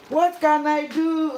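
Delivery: "What can I do?" cried high and loud; loud, high, helpless "What can I do?"; exasperated cry. Real, not acted. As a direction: throw up your hands and wail "What can I do?". Second take, loud and high.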